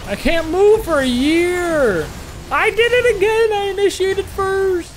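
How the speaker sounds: high, loud self-mocking yell; frustrated yell. Real, not acted. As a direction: shout it, exasperated at yourself. Raging at a misplay.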